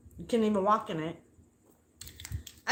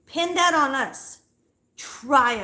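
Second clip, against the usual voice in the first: angry dare; 4 semitones higher, 5 dB louder, slower than usual. Real, not acted. Angry challenge to landlady.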